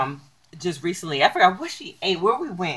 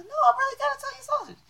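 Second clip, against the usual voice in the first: much higher and faster, insisting; mock insistent protest; 9 dB quieter, faster than usual. Telling a doubting friend, mock high protest. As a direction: squeak it out like a protest no one believes. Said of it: "I was like".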